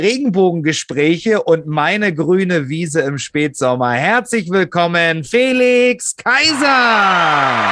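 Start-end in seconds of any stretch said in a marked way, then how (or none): 3.9-7.7 slow, drawn-out ring announcer call; lucha libre announcer. Acted. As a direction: stretch the name like a ring intro. Drawn-out ring-style intro of cohost.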